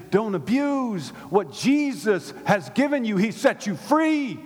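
high, loud preaching, drawn out; slow, emphatic exhortation. Acted. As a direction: plead with them to hear it.

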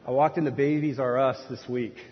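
normal talking voice

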